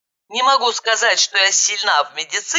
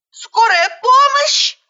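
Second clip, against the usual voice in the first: high, loud, drawn-out yell; 5 dB louder, slower than usual. A narrator's reading. Woman phoning for an ambulance in panic, yelling. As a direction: yell down the phone at full pitch. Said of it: "завопила, что было мочи".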